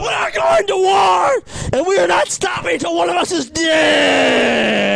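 slow, loud war declaration; rally the troops, shouting; battle cry shout. Real, not acted. Declares war in drinking game.

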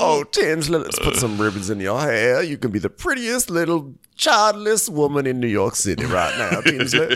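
lightly voiced Dale; a hint of Dale doting; Dale impression. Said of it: "I'm doing my Dale all day".

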